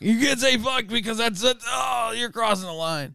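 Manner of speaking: mock prude. Mocking prudish objector.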